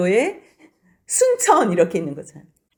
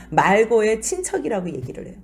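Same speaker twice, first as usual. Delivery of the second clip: normal talking voice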